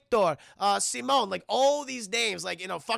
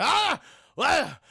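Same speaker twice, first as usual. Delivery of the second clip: pained yell; 8 semitones higher, 7 dB louder than usual. Retold or imitated. Waking in pain.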